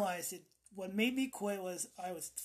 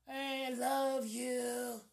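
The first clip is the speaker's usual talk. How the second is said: slow, higher, drawn-out smoker rasp; raspy smoker voice; 5 semitones higher, much slower than usual. Acted. Affectionate smoker to a visitor, raspy voice. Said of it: "Now I have that raspy voice".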